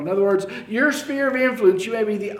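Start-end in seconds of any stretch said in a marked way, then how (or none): none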